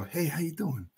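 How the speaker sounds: high, soft casual hello; mock-friendly greeting. Imagined friendly greeting, acted out.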